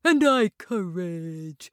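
slow timid lion; cowardly lion voice. A narrator's reading.

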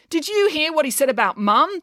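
high-pitched, outraged question; indignant demand. A narrator's reading. Finn demands April's support over Mum, indignant. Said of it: "demanded Finn".

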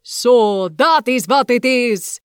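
slow, loud witch shriek. Acted. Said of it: "shrieked Baba Yaga".

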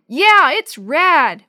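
high, drawn-out excited yell; loud, thrilled shout; yelling back. A narrator's reading. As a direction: yell back, stretching each word. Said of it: "Willie yelled back".

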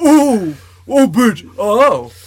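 high, loud gasping reactions; reaction noises. Retold or imitated. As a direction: overreact like a reaction video. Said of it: "I'm just going".

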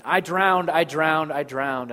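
loud, high mock cry, easing on each repeat; retold child's panicked cry. Us as children to the Father, mock-panicked cry. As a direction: imitate a child surfacing in panic. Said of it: "it will be like a child dipping in the pool".

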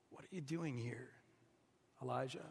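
God gently questioning the prophet, very softly; soft divine voice. A narrator's reading. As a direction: ask it gently like a still voice. God's voice in the cave.

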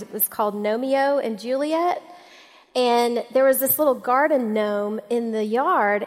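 ordinary talk

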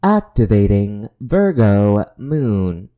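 slow, loud alien robot voice. Shown as a demonstration. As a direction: announce it like a computer. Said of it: "I said aloud just like this".

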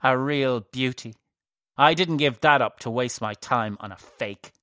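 loud, resentful outburst; bitter anger. A narrator's reading. Old priestess resents wasting time on the boy, bitterly angry. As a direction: throw it at him with loud resentment.

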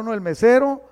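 normal talking voice

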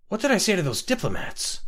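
high, anxious outburst; suddenly alarmed, asking in a panic; panicked cry. Acted. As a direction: hungover man panicking about last night. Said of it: "cried Scotty, panicking".

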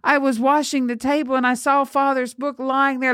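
high, earnest pleading voice; tearful protest. A narrator's reading. Tearful girl defending herself, high pleading. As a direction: explain yourself high, a touch tearful. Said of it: "tears running down her reddened face".

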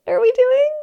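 high, loud startled question; alarmed surprise. Retold or imitated. As shamans blow plants up her nose, high startled alarm. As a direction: jolt up in mock alarm. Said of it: "I'm sitting here going".

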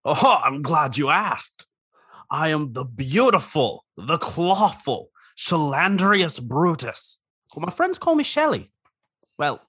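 lightly voiced vain crab; a touch of a grand showman crab; crab character voice.

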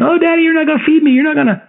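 put-on very high, fast whining kid; hammed-up sobbing child voice; crying child. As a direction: wail it like a boy sure he won't be fed. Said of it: "he cries and he's doing the same".

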